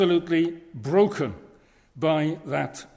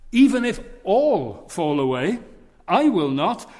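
louder, insistent boast; boastful protest; 3 semitones higher, 7 dB louder than usual. Retold or imitated. Preacher voicing Peter insisting to Jesus, loud and boastful. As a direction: proclaim it to the whole room. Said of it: "And Peter pipes up".

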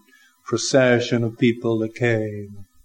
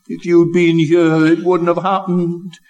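fervent reproach; 6 semitones higher, 4 dB louder than usual. Retold or imitated.

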